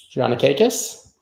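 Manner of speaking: "Giannakakis" rising high at the end as a question; unsure, questioning. Real, not acted. Streamer checking a viewer's name, "Giannakakis" asked with rising pitch. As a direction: ask "Giannakakis" with a lifted, doubtful ending. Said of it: "Tell me how it's pronounced".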